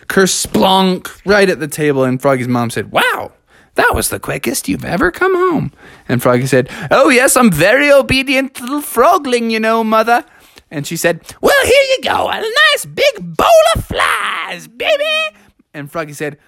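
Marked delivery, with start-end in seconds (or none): 2.9-5.7 high-pitched motherly amazement; acted impressed mother; surprised frog mom. Mother amazed at son's arrival. 6.9-10.2 put-on higher, prim good-child voice; playfully angelic son; dutiful little frogling. Frog plays the good child. 11.4-15.4 very high, singsong falsetto; hammed-up motherly cooing; doting frog mom. Mother serves dinner.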